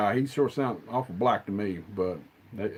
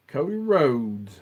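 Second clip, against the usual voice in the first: slow, stretched, louder wrestling-style name call; drawn-out announcer call; 3 semitones higher, 4 dB louder, much slower than usual. Shown as a demonstration. Hyping the wrestler's name to the viewers, slow announcer call.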